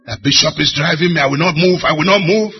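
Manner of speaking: mock protest of a car, loud and rising; crying car. Car objects to being driven by the bishop, mock protest. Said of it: "Is the car crying now?".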